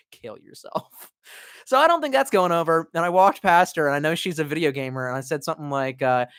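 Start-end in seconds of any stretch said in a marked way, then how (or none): 0.1-1.1 very slow, voiceless murmur; hushed repeat. Retold or imitated. Savoring own retort after laughing, hushed and slow. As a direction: breathe it out slowly, barely audible.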